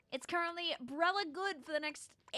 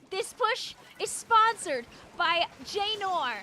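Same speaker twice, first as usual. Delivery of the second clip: announcer voice; 6 semitones higher, 7 dB louder, slower than usual. Pretend. Mock sponsor announcements.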